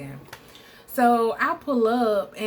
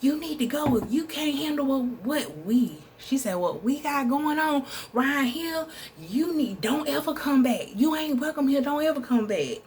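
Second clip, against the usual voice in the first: mother ordering her out; 6 semitones higher than usual. Retold or imitated. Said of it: "his mama came out the house talking about".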